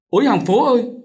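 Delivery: high, loud plea to the neighbours; cry for help. A narrator's reading. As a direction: call out desperately for rescue. Vợ cố kêu cứu. (kêu cứu thất thanh)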